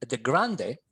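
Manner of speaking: normal talking voice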